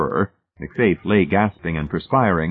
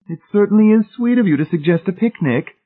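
very high, louder lady's voice; Missus Pritchett's gracious thanks; 5 dB louder than usual. A narrator's reading. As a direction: voice a gushing, high-pitched lady.

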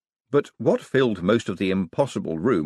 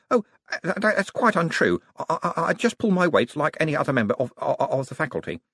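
higher-pitched, stammering protest; flustered young wizard; 7 semitones higher than usual. Acted. Young wizard modestly denying he does all the work, flustered. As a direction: stumble over it, embarrassed and modest.

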